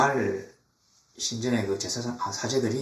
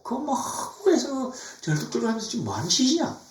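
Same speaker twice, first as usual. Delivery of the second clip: high-pitched mock disbelief; acted sneering reproach, pitched up; scornful scolding; 10 semitones higher than usual. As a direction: scoff at them as you scold. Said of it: "하면서 코웃음 치는".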